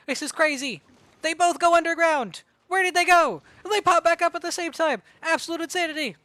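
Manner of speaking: loud, rising hype commentary; excited. Real, not acted. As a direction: call it like the crowd's on its feet. Hype moment in live commentary.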